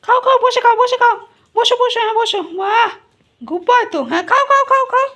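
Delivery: high, loud singsong coaxing the dog; pet baby talk. Acted. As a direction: talk to him like a toddler, high and bright. (উঁচু গলায় আদুরে সুরে কুকুরকে ডাকা)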